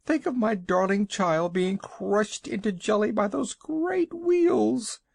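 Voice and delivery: very high, tearful fretting; anxious wailing. A narrator's reading. Mother frets to travellers about her child. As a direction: fret and wail as if the worst has happened.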